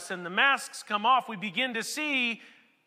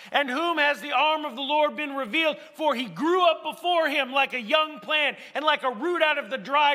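raised, declaiming reading of Isaiah; scripture reading; 4 semitones higher, 3 dB louder than usual. Acted. Preacher reading Isaiah to his congregation, raised and declaiming. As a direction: proclaim the prophecy to the congregation. Reads Isaiah fifty-three aloud.

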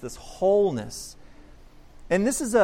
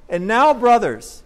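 louder, slower call of brothers; emphatic address; 4 semitones higher, 9 dB louder than usual. Acted. Peter addressing the crowd as brothers, slow and emphatic. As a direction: call out to the brothers warmly but strongly.

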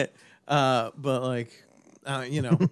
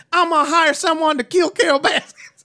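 much higher and louder cocky announcement; mocking a livestreamer bragging to viewers; mock boast; 9 dB louder than usual. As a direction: announce it like a proud idiot. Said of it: "be like".

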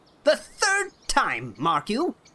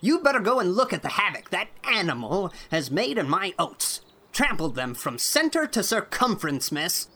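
put-on angry old man; 5 dB louder than usual. A narrator's reading. Irate farmer scolding Anne over the cow, furious. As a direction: rage at the girl, pounding each word.

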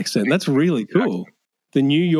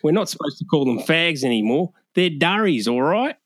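higher, sing-song Australian demo; Australian accent; 5 semitones higher than usual. Shown as a demonstration. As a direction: model the Australian line with rising endings. Models the Australian line back.

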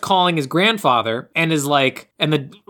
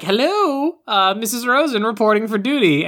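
chirpy Mrs Rosen; 6 semitones higher than usual. Retold or imitated. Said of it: "walks in and is like".

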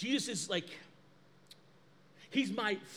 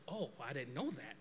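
much lower, much softer admission; sheepish aside; 10 semitones lower, 12 dB quieter than usual. Retold or imitated. Pastor admitting to his friend, low and soft. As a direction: mumble it, caught out. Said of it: "And I was like".

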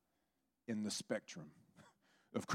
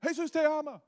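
high, loud street-evangelist holler; imitated yelling; 7 dB louder than usual. Imitating Chris yelling to people from the car, high holler. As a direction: yell it like out of a car window. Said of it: "and he's yelling".

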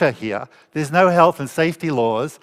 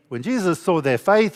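ordinary delivery

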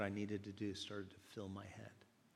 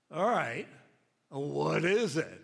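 very loud, higher, drawn-out demand; exasperated; 4 semitones higher, 11 dB louder, slower than usual. Retold or imitated. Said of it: "I'm getting frustrated, more and more frustrated".

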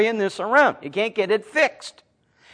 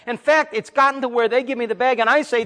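ordinary talk, nothing marked

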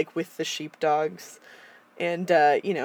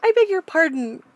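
polite pig; 9 semitones higher, 6 dB louder than usual. Retold or imitated. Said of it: "Babe is just like".

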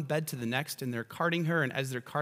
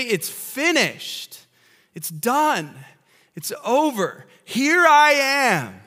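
Jesus declaring victory; 12 semitones higher, 12 dB louder, slower than usual. Acted. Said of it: "And he's gonna say".

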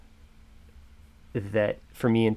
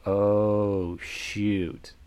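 long drawn-out "oh", falling away; mild sinking realization; retold dawning dread. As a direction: stretch it out as the trouble dawns. Retold dawning dread after mother's warning.

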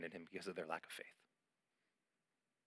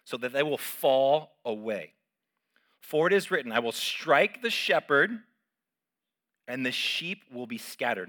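forceful scripture reading; 4 semitones higher, 11 dB louder than usual. Acted. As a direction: proclaim it to the congregation.

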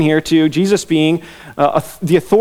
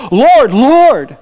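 "Lord, Lord" cried high and loud; raised, forceful plea on each "Lord"; emphatic; 7 semitones higher, 5 dB louder than usual. Retold or imitated. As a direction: punch both "Lord"s like an insistent claimant. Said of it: "They are being emphatic".

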